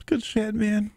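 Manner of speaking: high, choked-up voice fighting tears; real crying. Real, not acted. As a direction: overcome and tearful, pitch jumping up. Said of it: "the guy who's actually crying".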